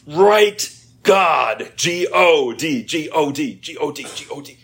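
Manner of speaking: loud, high, hammering out each letter; insistent professor. Retold or imitated. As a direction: drill the spelling into a stubborn student.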